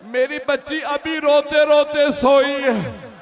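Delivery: loud, slow lament; stretched, loud grieving plea; mournful cry. Acted. Acted lament in mourning recitation.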